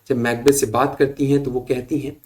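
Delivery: ordinary talk, nothing marked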